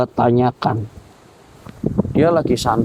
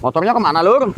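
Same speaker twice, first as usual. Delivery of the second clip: startled shout; 10 semitones higher, faster than usual. Real, not acted. Ghost hunter to his friend, a startled alarmed cry. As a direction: cry out in disbelief. Motorbike vanished, shocked.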